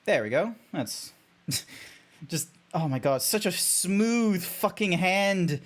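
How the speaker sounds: ordinary talk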